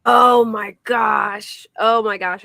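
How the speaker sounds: loud, slow drawn-out oh my gosh; surprised. Real, not acted. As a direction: react in real shock. Shocked by a new detail.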